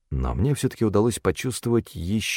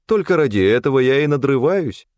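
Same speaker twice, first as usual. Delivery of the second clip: higher, mock-aggrieved cook's grumble; lightly voiced, put-upon cook; huffy cook; 7 semitones higher, 4 dB louder than usual. A narrator's reading. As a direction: huff it out in a raised cook's voice. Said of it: "хмыкнула кухарка".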